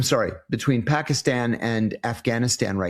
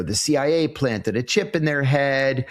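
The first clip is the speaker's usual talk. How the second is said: normal talking voice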